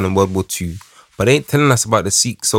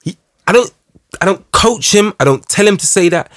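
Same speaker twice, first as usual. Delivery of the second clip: high, emphatic and lively; animated; 7 semitones higher than usual. Real, not acted. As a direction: say it with real excitement, high up. Animated, emphatic about his son.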